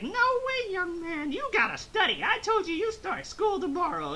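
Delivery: high scolding mock mother voice; Chi-Chi. Mother ordering young son to study, high mock scolding. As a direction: lay down the law in an exaggerated high pitch. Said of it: "And she's like".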